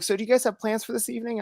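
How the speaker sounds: bright customer-service imitation; imitating cashier. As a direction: play the friendly clerk, fast and up. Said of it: "she's like".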